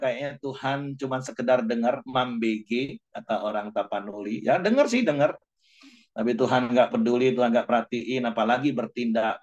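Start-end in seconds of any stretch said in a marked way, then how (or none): 4.4-5.4 mock dismissive. Mocking God barely listening.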